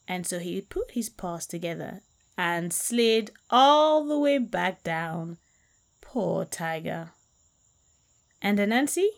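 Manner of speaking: lively storyteller voice reading a folktale; storyteller narrating a folktale for listeners; story narration. A narrator's reading. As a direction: tell it like a fireside tale, animated.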